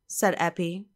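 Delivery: ordinary delivery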